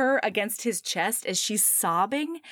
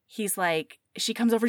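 ordinary delivery

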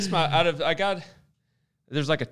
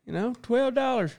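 high, outraged customer complaint; whiny customer; 11 semitones higher than usual. Retold or imitated. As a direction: squeal it like a cheated buyer. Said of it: "Somebody's gonna be like".

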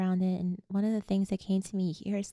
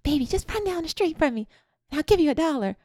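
put-on much higher sing-song calling to a kid; coaxing neighbor; 8 semitones higher, 4 dB louder, faster than usual. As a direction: call sweetly to a child on the street. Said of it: "people would see me on the street, like".

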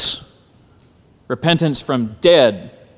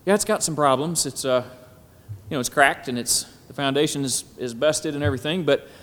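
mock self-talk; 7 semitones higher, 5 dB louder than usual. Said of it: "we say".

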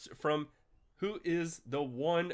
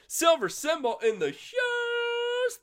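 very high, drawn-out shout on house; hype shout; slower than usual. Real, not acted. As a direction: yell it like a hype man. Hyping a chat member.

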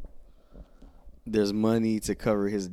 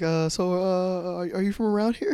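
higher, louder nervous question; awkward flirt; 10 semitones higher, 4 dB louder than usual.

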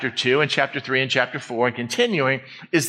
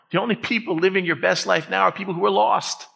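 normal talking voice

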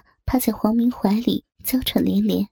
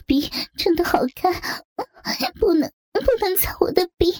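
higher, half-whispered pleading; pleading woman; 7 semitones higher than usual. Acted. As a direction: protest weakly, breath catching.

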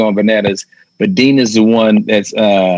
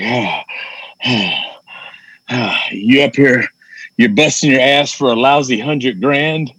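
out of breath; slower than usual. Retold or imitated. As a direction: gasp between the words like a winded showman. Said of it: "he's breathing heavy".

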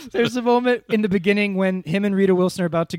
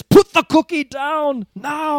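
much higher, slow, shouted Arnold voice; Schwarzenegger impression; 9 semitones higher, 5 dB louder, slower than usual. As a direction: bark the order like Arnold. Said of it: "the equivalent of that line".

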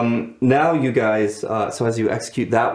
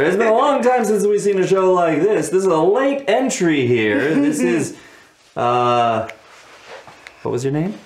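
high, projected arena announcer; big swooping event-commentary voice; show announcer; 8 semitones higher, 3 dB louder than usual. Acted. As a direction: call it out to the stands as emcee. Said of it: "You hear the announcer".

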